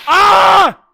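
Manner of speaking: short, loud, high scream. Acted. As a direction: scream as if attacked. Screams as tiger attacks.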